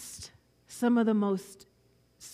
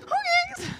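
put-on little girl agreeing; 4 dB louder than usual.

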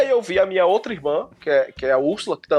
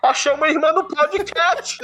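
laughing; 9 semitones higher than usual. Real, not acted. Joking punchline with laughter.

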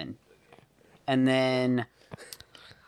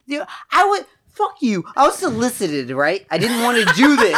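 excited; 8 semitones higher, 5 dB louder than usual. Retold or imitated. Retells being solicited, loud high.

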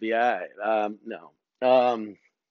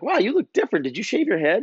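high-pitched voice of surprised woman; high mock astonishment; imitated surprise; 10 semitones higher, faster than usual. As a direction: mimic her amazed questions, pitched way up.

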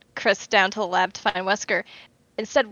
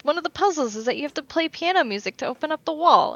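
higher-pitched, eager explanation; retold fan explaining, pitched up; 7 semitones higher than usual. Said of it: "Yeah, I was like".

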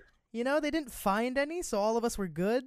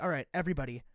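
lower, commanding teacher voice; imitating the teacher confiscating phones; stern teacher; 6 semitones lower than usual. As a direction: bark the rule at the campers.

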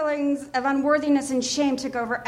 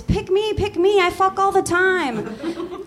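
eager volunteer; 6 semitones higher, slower than usual. Retold or imitated.

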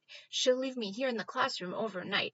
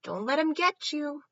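high, louder fussy advice; fussy rabbit; 7 semitones higher, 6 dB louder than usual. Acted. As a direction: chirp useless warnings as a rabbit. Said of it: "She's all like".

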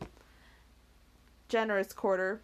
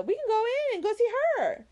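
much higher, eager exclamation; eager Miss Steele crying out; 14 semitones higher than usual. A narrator's reading. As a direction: blurt it out eagerly. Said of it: "cried Miss Steele".

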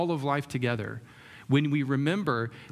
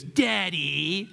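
high, drawn-out mock call rising at the end; estranged son's taunt; 4 semitones higher than usual. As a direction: stretch it out high, needling your father. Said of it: "like that".

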